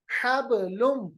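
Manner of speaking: slow हबलुम with bounced b; qalqalah. Shown as a demonstration. Teacher shows the whole word, हबलुम with springing b. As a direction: echo the b in हबलुम. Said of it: "हब नहीं पढ़ते".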